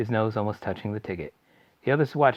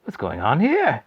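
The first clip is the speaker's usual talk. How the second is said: bewildered; 5 semitones higher, 7 dB louder, slower than usual. Acted. Mr Bucket bewildered.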